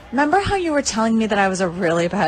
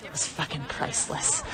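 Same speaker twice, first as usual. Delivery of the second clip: awed whisper; 8 semitones lower, 13 dB quieter than usual. Real, not acted. Marvelling at his face.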